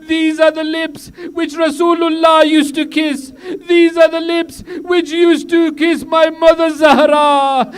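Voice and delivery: high, loud wailing cry of rebuke; Zainab's rebuke. Acted. Zainab shaming Yazid in his court, high wailing rebuke. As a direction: cry out at him, slow, grief and outrage together. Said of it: "Janab Zainab says".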